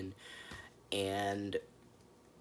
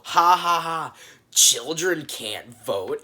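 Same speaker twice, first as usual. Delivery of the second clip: loud mocking laugh then slow sneer; mock condescending ha ha, children can't vote; mocking adults; 4 semitones higher, 7 dB louder, slower than usual. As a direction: ham up the smug adults laughing at a kid. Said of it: "laugh at her and just go".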